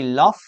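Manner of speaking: LOVE said with a final F; wrong pronunciation. Shown as a demonstration. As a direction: end LOVE on an unvoiced F, "luff". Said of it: "Is it correct to say love?".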